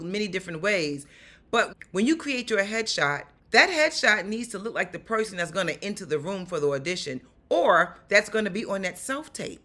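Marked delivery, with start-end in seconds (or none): none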